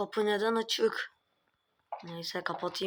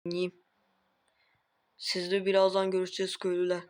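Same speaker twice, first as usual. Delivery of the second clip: usual speaking voice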